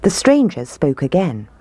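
ordinary delivery

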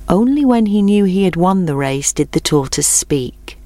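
ordinary delivery, nothing marked

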